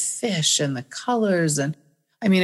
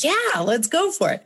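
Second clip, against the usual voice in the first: eager yes; 12 semitones higher than usual. Retold or imitated. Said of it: "I'm like".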